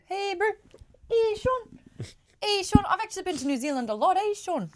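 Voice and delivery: put-on high, playful New Zealand accent; teasing Kiwi accent. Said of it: "Sean's a Kiwi, eh, bro?".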